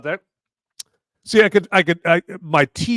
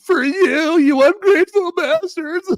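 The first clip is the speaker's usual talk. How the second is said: mock indignation; 5 dB louder than usual. Mock outrage at viewers.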